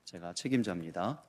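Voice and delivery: humbly smooth it over with the client; gently deferential sorry; polite apology. Retold or imitated. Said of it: "정중하게".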